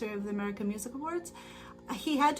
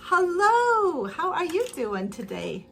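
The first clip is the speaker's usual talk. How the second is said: imitating his greeting, higher and louder; hammed-up friendly hello, then slowing; mock cheerful greeting; 5 semitones higher, 5 dB louder, slower than usual. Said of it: "he goes".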